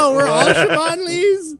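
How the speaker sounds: high dazed stoner surprise; wide-eyed stoned whoa. Retold or imitated. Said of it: "He's like".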